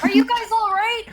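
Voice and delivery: mock concerned call. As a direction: call down to them in feigned concern. Calls down to guards in pretend.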